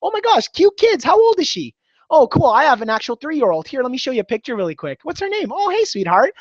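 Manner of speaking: bubbly, chatty delight with the customer; friendly. Shown as a demonstration. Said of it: "Then you can start becoming friendly".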